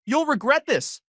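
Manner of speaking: high, shrill cracking shriek. Acted. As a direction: shriek it, voice breaking. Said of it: "Lauren shrieked, voice cracking".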